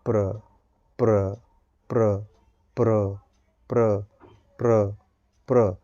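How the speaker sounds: low soft practice syllables; soft lips. Shown as a demonstration. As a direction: let प्र slip out gently. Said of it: "होंठों पे pressure नहीं आना चाहिए".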